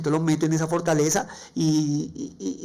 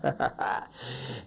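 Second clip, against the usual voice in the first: quiet unvoiced chuckle, softer; breathy chuckle; 2 semitones lower, 6 dB quieter than usual. Real, not acted. Joking that he now does a podcast, quiet chuckle. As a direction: let out a soft breath of a laugh. Laughing after podcast joke.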